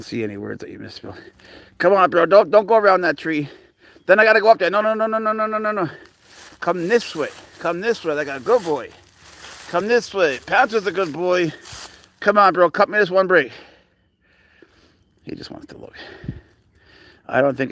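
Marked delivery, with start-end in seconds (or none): 1.8-13.5 urgent high-pitched pet talk, begging him to come; coaxing the pet. Real, not acted. Coaxing the serval down from tree. 15.2-15.9 hushed whisper aside; soft whisper so the cat isn't startled. Real, not acted. Hushed aside while cat explores.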